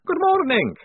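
high, loud vampire greeting; vampire hanging upside down says hello, high and loud; vampire Otto. A narrator's reading. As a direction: pitch the vampire way up and bright.

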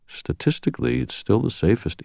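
a hint of a measured older man; Michael's voice. A narrator's reading. Michael's voice in reading.

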